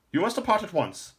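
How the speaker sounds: put-on high, loud old man's voice; an urgent old lore master.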